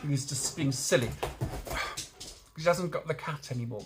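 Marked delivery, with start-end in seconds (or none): 1.1-2.3 weary sigh. Real, not acted. Exasperated by the dog.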